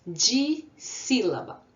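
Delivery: "Dissílaba" said slowly, each syllable apart; syllables drawn out. Shown as a demonstration. Teacher slowly sounding out the new word. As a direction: stretch "Dissílaba" into its parts. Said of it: "Como que a gente chama".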